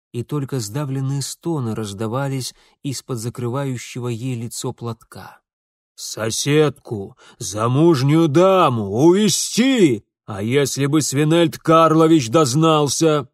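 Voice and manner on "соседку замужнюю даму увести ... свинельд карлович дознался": loud, incredulous father's tirade; outraged scolding. Acted. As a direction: hammer out each accusation in outrage. Father rages at his eldest son.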